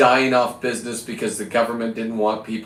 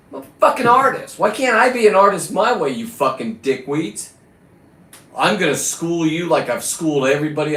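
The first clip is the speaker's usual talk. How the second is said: angry outburst; 5 semitones higher than usual. Real, not acted. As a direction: blow up at them, indignant. Ranting about being kicked out.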